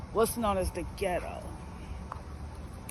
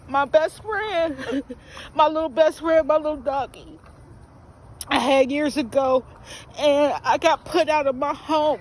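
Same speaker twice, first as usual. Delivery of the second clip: raised, louder voice close to tears; emotional confession; 5 semitones higher, 6 dB louder than usual. Real, not acted. She confesses to viewers about her little dog, near tears. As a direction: confess it while fighting tears. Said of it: "I'm very emotional right now".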